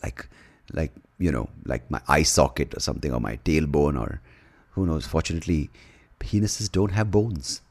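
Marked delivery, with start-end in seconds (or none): none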